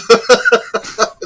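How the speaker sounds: loud high laugh. Real, not acted. Guest laughing mid-story to the interviewer, loud laugh. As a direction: laugh hard at the memory.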